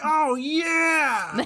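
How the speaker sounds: high joyful cartoon shout; cartoonishly happy. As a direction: yell it like a cartoon character. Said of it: "cartoonishly happy expressions".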